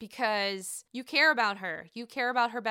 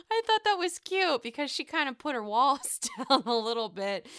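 talking through laughter; amused at a sweet moment; laughing; 2 semitones higher than usual. Real, not acted. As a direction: chuckle while you say it. Laughing commentary.

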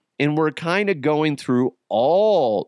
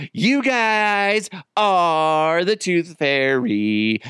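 put-on very slow, stretched-out kid announcement; hammed-up drawling child; imitating gleeful son; 2 semitones higher, much slower than usual. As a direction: drag out each word like a boy revealing a secret. Imitates son's announcement to mom.

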